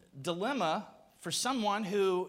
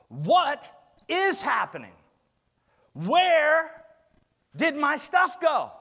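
louder, higher pleading questions; anguished crying out; 6 semitones higher, 7 dB louder, much slower than usual. Acted. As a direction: cry out like someone lost. Voicing sufferer's questions.

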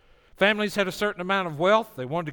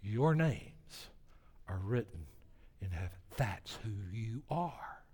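much softer and lower, slowly spaced; tender assurance; 7 semitones lower, 11 dB quieter than usual. Real, not acted. Preacher assuring the congregation of their identity, soft and slow. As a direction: let each word land gently. Sermon climax, intimate assurance.

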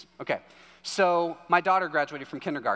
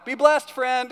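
cheery shout from a rolled-down window, high and loud; calling out; 9 semitones higher, 9 dB louder, slower than usual. Retold or imitated. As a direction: call a blessing to someone as you drive off. Calling from car driving off.

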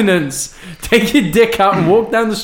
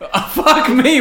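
laughing; 9 semitones higher, slower than usual. Real, not acted. Reacting to being shot.

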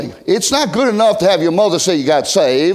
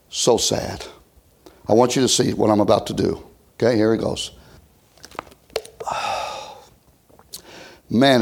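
hushed solemn; 8 semitones lower, 4 dB quieter than usual. Acted. Quiet solemn reflection.

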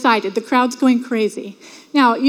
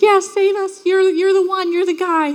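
higher, excited voice of a cheering fan; cheering crowd; 6 semitones higher than usual. Retold or imitated. Preacher voicing the crowd cheering for Jesus, excited and higher. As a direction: cheer him on like the hyped crowd. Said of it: "the crowds cheering and being like".

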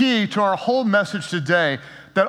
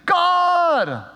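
relieved joy; 8 semitones higher than usual. Retold or imitated. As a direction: burst out thanking God. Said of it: "his tone changes".

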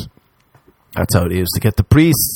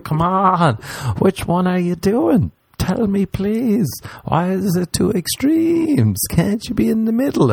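higher, slow pleading whine; exasperated body; 11 semitones higher than usual. Pretend. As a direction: beg like a tired body. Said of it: "my body's like".

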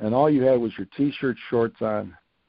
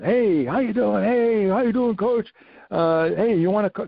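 imitating Coach Ferguson; 8 semitones higher than usual. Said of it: "phone call from Coach Ferguson".

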